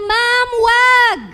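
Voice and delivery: high pleading cry of ma'am don't; mock alarmed shout; retold student shout. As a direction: kids begging teacher to stop. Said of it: "sumigaw sila".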